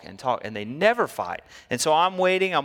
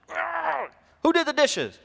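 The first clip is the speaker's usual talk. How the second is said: much higher, strained frustrated cry; exasperated outburst; slower than usual. Retold or imitated. Parent finding dirty dishes, exasperated and high. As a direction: growl then demand who did it.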